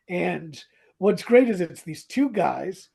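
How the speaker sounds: ordinary talk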